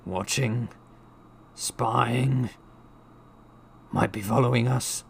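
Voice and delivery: wild mutter. A narrator's reading. Uncle fearing he is being followed, wild muttering. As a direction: mutter suspicions in fragments, with pauses. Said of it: "muttered Uncle Vernon wildly".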